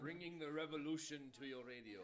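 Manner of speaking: loud mock-propaganda radio slogan; joking, booming comrade address; mock revolutionary announcer. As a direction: proclaim it like a rallying radio spot. Jokey mock propaganda radio tag.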